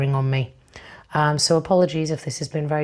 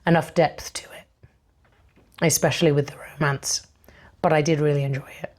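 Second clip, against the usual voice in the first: losing voice; faster than usual. Real, not acted. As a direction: voice fading to a whisper. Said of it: "the fact that I'm losing my voice".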